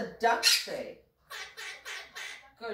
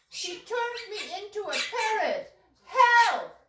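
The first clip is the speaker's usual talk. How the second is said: mock cry for help; 11 semitones higher, 4 dB louder than usual. Cries for help, turned into parrot.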